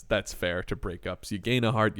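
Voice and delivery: ordinary delivery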